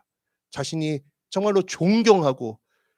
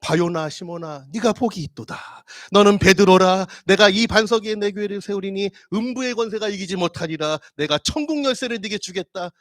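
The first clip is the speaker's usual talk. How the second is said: blessing; 5 semitones higher, 5 dB louder than usual. Acted. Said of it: "예수님이".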